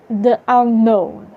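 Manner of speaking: ordinary talk, nothing marked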